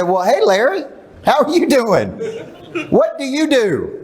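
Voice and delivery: high, amused, over-sweet hello to a stranger; mock friendly greeting. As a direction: greet him with a laugh in your voice.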